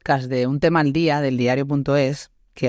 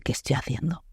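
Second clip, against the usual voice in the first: hushed self-question; 8 dB quieter than usual. Retold or imitated. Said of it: "de decir".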